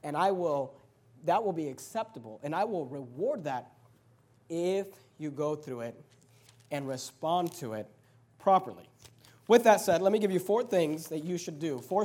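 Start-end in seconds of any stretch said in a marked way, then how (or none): 0.0-8.9 much lower and softer, unhurried; a lightly voiced God promising reward, soft and low; gentle God voice. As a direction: reassure them quietly as God would. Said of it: "then God says".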